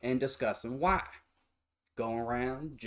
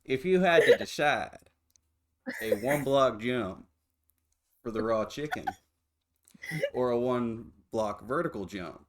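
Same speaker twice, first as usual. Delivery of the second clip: slow put-on Southern drawl. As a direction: lay on a thick Southern drawl. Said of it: "I can't take you seriously with this fucking drawl".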